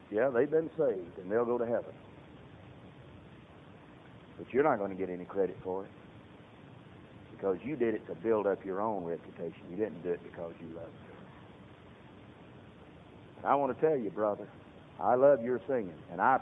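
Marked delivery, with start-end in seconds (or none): 0.1-11.1 low, soft, grave divine reply; voice of God, hushed. Retold or imitated. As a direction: speak as God, quietly and gravely. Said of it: "And the Lord said".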